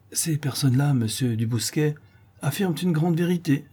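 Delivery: ordinary delivery, nothing marked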